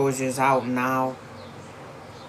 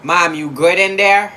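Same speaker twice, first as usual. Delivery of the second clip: calling out; 4 semitones higher, 9 dB louder than usual. Real, not acted. Calling to mom in another room.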